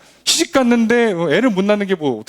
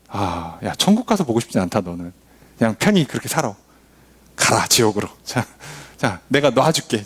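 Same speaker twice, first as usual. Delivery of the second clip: voicing Jonah; 6 semitones lower than usual. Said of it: "이런 마음으로 보내주는 거잖아요".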